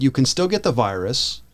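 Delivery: ordinary delivery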